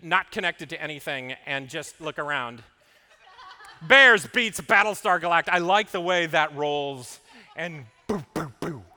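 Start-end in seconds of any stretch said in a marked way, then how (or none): 3.8-5.5 loud deadpan. Retold or imitated. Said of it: "I will say it on occasion".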